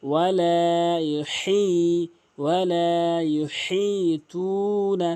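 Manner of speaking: slow melodic chant, ইল্লা lifted; Quran recitation. Shown as a demonstration. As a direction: recite slowly, lifting ইল্লা.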